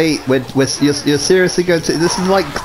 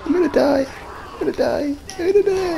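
mock panic; 10 semitones higher, 6 dB quieter, much faster than usual. Real, not acted. Losing the game.

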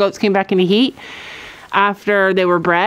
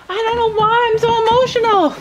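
emotional; 11 semitones higher than usual. Real, not acted. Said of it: "this is an emotional vlog".

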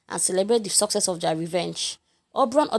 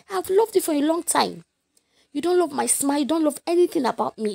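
higher, loud wounded plea; lightly voiced hurt young woman; hurt Helena; 8 semitones higher, 4 dB louder than usual. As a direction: plead like a scorned friend.

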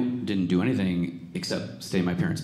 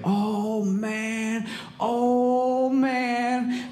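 much higher, astonished oh man; amazed cousin; much slower than usual. Retold or imitated. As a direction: gasp it out in disbelief. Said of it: "He's like".

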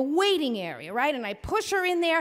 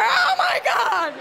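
anguished cry; 12 semitones higher, 5 dB louder, slower than usual. Retold or imitated. Wife screaming in labor.

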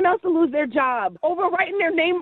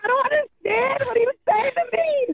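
1 tearful; 7 semitones higher than usual. Real, not acted. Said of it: "After I stop crying".

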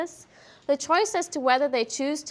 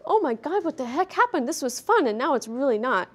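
higher, alarmed, then deflating; sudden dismay after fun; shocked dog voice; 3 semitones higher than usual. Retold or imitated. Instructor voicing the corrected dog's shock, alarmed. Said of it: "This dog is like".

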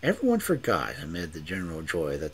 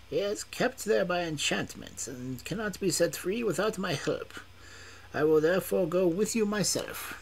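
much higher, calm and measured; old magician; 12 semitones higher than usual. A narrator's reading. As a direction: speak as a wise old magician.

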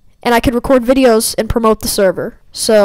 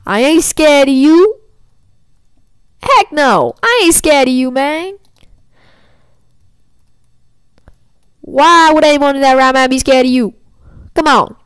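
mock-tough taunt; 3 semitones higher, 3 dB louder than usual. Player daring his opponent to fight, mock-tough taunt. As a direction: puff yourself up and scoff at the challenger.

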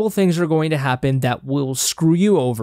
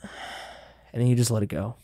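letting-go sigh; 4 semitones lower, 7 dB quieter than usual. Shown as a demonstration. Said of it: "you just kinda, like".

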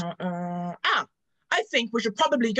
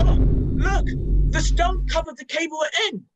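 much higher, louder alarmed cry; shocked; 6 semitones higher, 8 dB louder than usual. Acted. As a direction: gasp out the news in shock. Shocked as stone covers cave.

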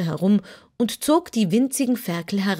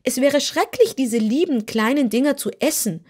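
put-on higher, louder scolding girl's voice; reproachful; 6 semitones higher, 3 dB louder than usual. Dorothy scolds the hungry kitten, reproachful. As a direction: scold the kitten for its heartless question. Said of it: "sagte Dorothy vorwurfsvoll".

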